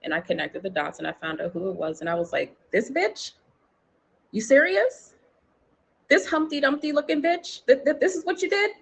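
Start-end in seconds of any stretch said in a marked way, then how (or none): 2.7-8.7 high, loud incredulous outrage; outraged disbelief. Retold or imitated. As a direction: scoff in furious disbelief. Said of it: "I was so mad".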